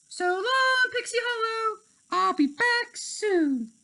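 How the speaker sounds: high, loud happy call; cheerful farewell. A narrator's reading. Tink waving goodbye to Pixie Hollow, high cheerful call. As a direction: call it out gaily, slowing at the end.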